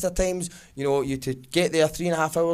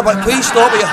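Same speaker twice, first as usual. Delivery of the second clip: high, loud, exasperated plea; frantic protest; loud pleading; 6 semitones higher, 10 dB louder than usual. Acted. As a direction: beg them loudly to stop. Pleading as labels go on brother.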